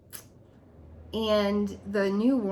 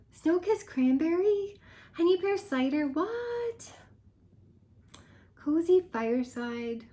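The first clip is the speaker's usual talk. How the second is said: disbelief; 7 semitones higher than usual. Real, not acted. Listing retired favorites, pitch rising.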